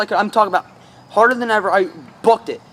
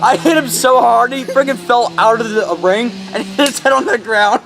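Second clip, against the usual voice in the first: ordinary talk, nothing marked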